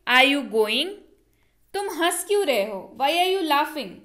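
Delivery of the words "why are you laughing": usual speaking voice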